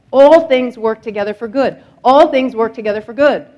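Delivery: rising sermon proclamation; preaching at full force. Acted. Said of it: "repeated verse".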